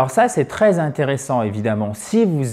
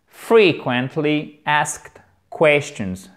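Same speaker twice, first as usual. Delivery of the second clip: Frequently asked questions spaced out word by word, very slowly; slow pronunciation model; much slower than usual. Shown as a demonstration. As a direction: spell out each sound for learners to copy. Teacher models English phrase slowly.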